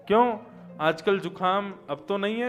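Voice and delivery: ordinary talk, nothing marked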